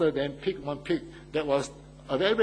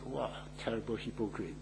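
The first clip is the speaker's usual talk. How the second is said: mocking aside; 4 semitones lower, 10 dB quieter than usual. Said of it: "You say".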